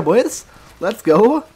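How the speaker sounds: normal talking voice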